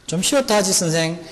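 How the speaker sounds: high, loud cocky retort; flustered student sassing the teacher; cheeky reply. Pretend. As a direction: talk back to the teacher. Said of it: "너무 당황한 나머지".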